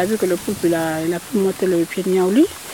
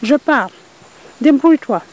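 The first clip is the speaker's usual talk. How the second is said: voix de poule haute et forte qui reproche; la poule lâche le rat, plus haute et plus forte; poule sultane fâchée; 6 semitones higher, 5 dB louder than usual. Acted. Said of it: "la personne a dit". (la poule abandonne le rat, voix haute et forte)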